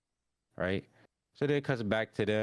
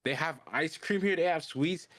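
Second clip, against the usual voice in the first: higher, eager sweet-tooth voice; excited imitation; 7 semitones higher than usual. Rudo spotting ice cream and sweets, excited. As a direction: play excited about treats, pitched up.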